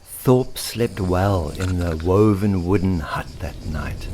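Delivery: plain reading voice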